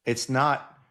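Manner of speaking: normal talking voice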